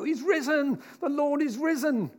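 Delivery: high, excited proclamation; enthusiastic. Retold or imitated. Disciples telling Thomas the news, excited and high. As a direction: cry it out with overjoyed disciple energy. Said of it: "they're very enthusiastic".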